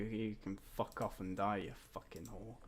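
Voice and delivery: low, soft grumbled cursing; bitter muttering. Real, not acted. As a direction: mutter the threat under your breath. Muttering curses at the character.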